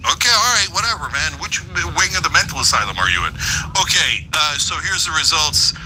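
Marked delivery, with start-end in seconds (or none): none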